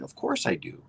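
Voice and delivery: normal talking voice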